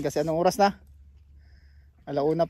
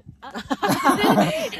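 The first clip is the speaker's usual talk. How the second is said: high burst of laughter; 7 dB louder than usual. Real, not acted. Laughing at the interruption.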